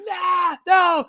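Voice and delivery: put-on high, loud toddler protest; high toddler yell of no; toddler wailing no. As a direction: scream no like a tantruming toddler. Toddler tantrum carried out.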